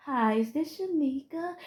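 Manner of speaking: high, soft, slow sugary voice; talking down to a kid. Retold or imitated. Imitating the caller who treats her like a kid. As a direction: coo it like she's a child. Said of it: "why are you talking to me like I'm a kid".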